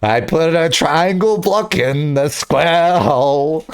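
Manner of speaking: slow, put-on rapper voice with a laugh breaking in; mock rapper drawl, lightly voiced. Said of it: "And he's like".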